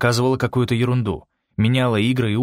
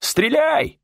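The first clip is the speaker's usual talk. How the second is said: strained high cry; yelled command. A narrator's reading. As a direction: yell it high, like a frantic order. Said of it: "завопил кто-то".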